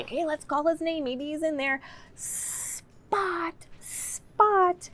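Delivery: playful high storytime call; calling Spot. Shown as a demonstration. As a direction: call "Spot, Spot" like a lost puppy. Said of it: "usually I have them say".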